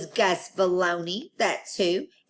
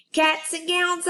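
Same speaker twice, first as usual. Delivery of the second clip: hollering; 10 semitones higher than usual. Acted. Junie B hollering the news to room nine. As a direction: holler it out with joy. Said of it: "I hollered".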